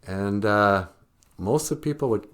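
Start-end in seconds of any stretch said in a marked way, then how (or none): none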